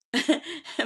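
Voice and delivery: high, amused laugh; spontaneous giggle; real laugh. Real, not acted. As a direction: burst out laughing at yourself. Amused by her own confession.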